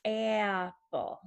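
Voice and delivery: slow "apple" with a long-held "aah" start; short A. Shown as a demonstration. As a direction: isolate the first vowel of "apple", then finish the word. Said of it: "the short sound is".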